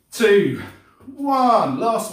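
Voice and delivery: loud, high countdown; countdown call. Real, not acted. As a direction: call the last seconds out. Counting down the final seconds.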